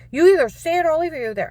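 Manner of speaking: high, loud threatening shout; shouted threat. Retold or imitated. Agent threatening to leave her there, shouting. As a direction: hurl the ultimatum.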